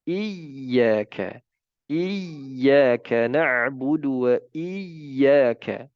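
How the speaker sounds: slow, "iyyaka" with the doubled y held firm; careful teacher's recitation; correct tasydid. Shown as a demonstration. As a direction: recite "Iyyaka na'budu" with the proper shadda.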